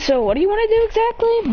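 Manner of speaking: high, bouncy question; playful high voice. Acted. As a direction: ask it like an excited kid.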